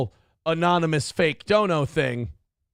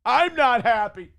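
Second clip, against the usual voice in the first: mock shouting, drawn out and higher; hammed-up bitter complainer, raised strained voice; angry, bitter people; 5 semitones higher, slower than usual. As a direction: imitate an angry mob, slow and strained. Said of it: "a whole army of really angry, bitter people going".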